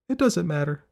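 put-on high child's voice, brushing it off; dismissive girl. A narrator's reading. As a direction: shut down your mother's question. Girl brushes off her mother.

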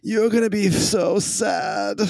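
high, mocking taunt with a laugh in it; gloating rival. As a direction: taunt him cheerfully, pitched well up. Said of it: "Jesus looks over at Pawson and says".

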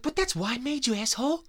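hammed-up outraged boss scolding quitters; whiny boss god. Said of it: "I was like".

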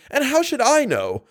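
high, incredulous question; genuinely baffled; astounded. A narrator's reading.

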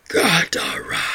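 breathy whispered beast speech; creature whisper. Acted. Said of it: "attempting to actually speak".

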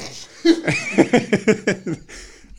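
loud high cackle; big laugh. Real, not acted. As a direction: burst out laughing. Laughs at his own insult.

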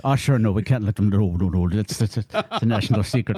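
low, mock-secretive Irish brogue; guard a national secret in a put-on Irish voice; Irish relatives. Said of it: "I couldn't confirm with any Irish relatives".

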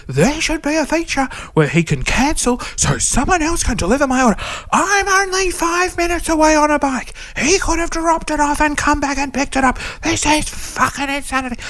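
hammed-up high rant at the empty room; retold furious rant. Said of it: "So I'm going off".

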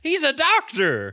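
high, loud mocking yell; incredulous shout. Real, not acted. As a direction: shout it in disbelief. Mocking the doctor character.

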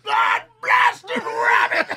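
high, loud cartoon cowboy yell; Yosemite Sam. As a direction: holler like a furious old prospector. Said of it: "Yosemite Sam is underrated".

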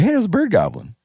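high-pitched imitation of an alerted hunter; imitating other hunters. Host mimics rival hunters hearing a turkey, high imitation. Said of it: "other people are gonna be like".

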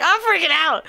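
high loud mock panic; panicked. Nervous at the door before the threesome, high panicky cry. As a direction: blurt it like you're losing it. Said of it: "And I'm like".

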